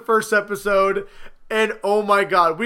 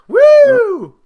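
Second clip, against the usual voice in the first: much higher, loud whoop; victory whoop; 14 dB louder than usual. Real, not acted. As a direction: cheer the win. Victory cheer.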